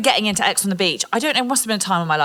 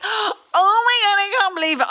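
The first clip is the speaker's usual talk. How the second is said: mock shock; 12 semitones higher than usual. Mocking how contestants greet an ex, hammed-up shock. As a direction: overdo the astonishment, high and wailing. Mocks reaction she would not have.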